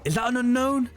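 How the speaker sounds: high, eager questioning; lightly voiced excited asking; curious wondering. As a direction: peer at it hopefully. Imagining spotting an unknown.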